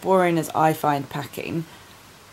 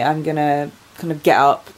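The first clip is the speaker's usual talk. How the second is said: usual speaking voice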